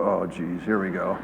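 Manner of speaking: low, soft mock groan; weary kids. Kids groaning at dad being recognized, low and soft. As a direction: sigh it like embarrassed kids. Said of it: "you can see my kids go".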